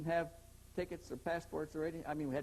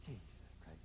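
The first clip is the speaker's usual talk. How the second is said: awed low mutter; 10 semitones lower, 14 dB quieter than usual. Retold or imitated. Man stares at the returned book.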